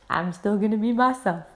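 ordinary delivery

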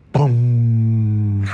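mouth-made boom; a loud, spat-out "Boom!"; kid-style explosion noise; explosion sound. Acted. Dynamite explodes.

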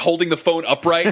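ordinary talk, nothing marked

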